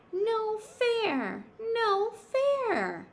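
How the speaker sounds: high, loud bird cry of protest. Acted. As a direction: cry out so all the animals hear. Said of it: "she cried out for all the animals to hear".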